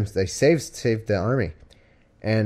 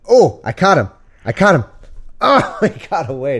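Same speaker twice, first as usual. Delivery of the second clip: real excited outburst, higher and louder; excited triumph; 6 semitones higher, 6 dB louder than usual. Real, not acted. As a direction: cheer at catching the cat. Catching the cat under couch.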